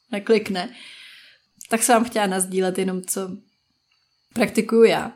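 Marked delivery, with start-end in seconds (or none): none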